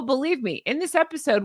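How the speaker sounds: ordinary delivery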